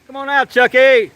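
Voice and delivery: long, high, loud call. Real, not acted. Calling the polar bear out of his cave, slow loud holler. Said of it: "give me a name to yell out".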